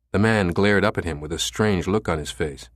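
usual speaking voice

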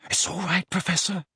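gentle, worried comforting; reassuring. A narrator's reading. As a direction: soothe him though frightened yourself. Harry comforts the suffering Dumbledore.

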